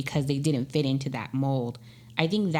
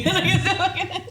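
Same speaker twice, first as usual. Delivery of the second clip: high, louder laugh through words; laughing hard; 4 dB louder than usual. Real, not acted. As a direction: burst out laughing on it. Repeats the line laughing harder.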